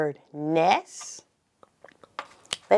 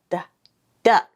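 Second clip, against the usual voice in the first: ordinary talk, nothing marked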